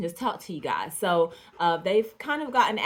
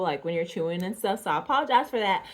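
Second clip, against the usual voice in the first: normal talking voice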